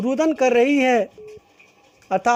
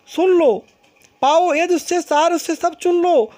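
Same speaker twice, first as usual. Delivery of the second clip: higher and louder, urging them to listen; raised pleading voice; 6 semitones higher, 4 dB louder than usual. Acted. Kaikeyi begging the assembly to hear her out, raised pleading voice. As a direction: plead with the whole court, voice lifted.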